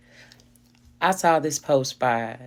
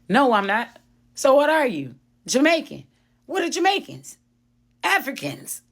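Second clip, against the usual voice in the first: heated mock exchange; 10 semitones higher than usual. Retold online argument, both voices.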